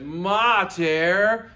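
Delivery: high, loud whining call of mater; whiny child. Acted. Child whining to mom, calling out mater. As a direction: whine like a kid pestering mom.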